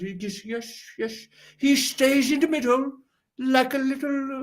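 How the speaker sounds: high-pitched, hammed-up British accent; put-on British accent. Shown as a demonstration. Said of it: "do I have a good British accent".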